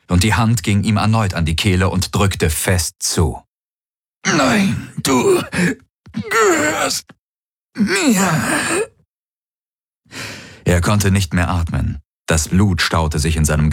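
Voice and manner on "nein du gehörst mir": very high, slow, drawn-out eerie words; possessed voice. A narrator's reading. The Helix claiming Garland, eerie possessed voice. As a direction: let the Helix speak through him.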